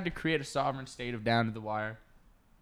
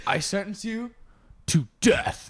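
higher, mock-solemn judge voice; sentencing judge; 7 semitones higher, 4 dB louder, slower than usual. Judge sentencing a bear in court, mock-solemn. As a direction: pronounce sentence with a dramatic pause. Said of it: "and the judge is".

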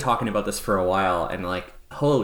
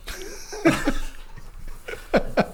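giggle; 3 dB quieter than usual. Real, not acted. Laughs after scaring friend.

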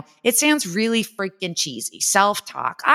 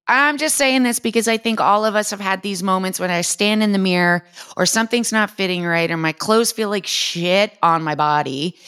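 usual speaking voice

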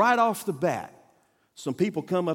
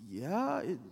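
put-on small, soft, high little-girl voice; puzzled child imitation, slow and tentative; Diane; 6 semitones higher, 9 dB quieter, slower than usual. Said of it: "she looked at the class and goes".

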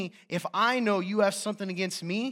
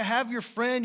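usual speaking voice

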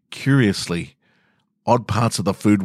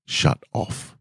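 whispered; 5 dB quieter than usual. Acted. Narrator describing shutting down.

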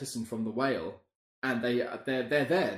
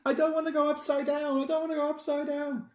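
put-on much higher, loud panicked child fish; mock panic; 5 dB louder than usual. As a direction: wail it like a terrified little fish. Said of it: "He's like".

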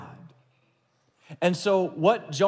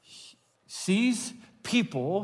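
ordinary delivery

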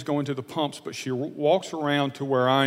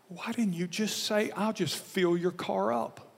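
self-reproach; 6 semitones higher, 4 dB quieter than usual. Retold or imitated. To himself as the car disappears, a higher, slowed self-reproach. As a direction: ask yourself in mild dismay why you missed it. Berating himself as she leaves.

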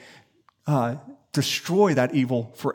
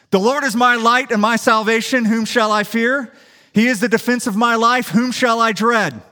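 louder, higher proclaimed yell; yelling scripture; 7 semitones higher, 5 dB louder than usual. Retold or imitated. Pastor alone in dark church yelling Psalm 27. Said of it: "I just yell it out".

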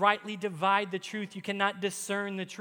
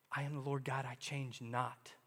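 voice of God, lightly; 4 semitones lower, 6 dB quieter than usual. Acted. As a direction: let God speak quietly, unhurried. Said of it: "quoting God's words".

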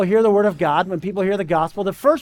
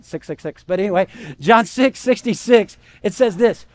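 usual speaking voice

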